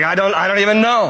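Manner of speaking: baffled thief. Retold or imitated. Baffled thief answers angel.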